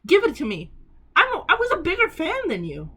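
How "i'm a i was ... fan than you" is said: much higher, louder, a playful protest at a friend; mock indignant. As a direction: pitch it up like a jealous fan. Playfully demanding friend's record.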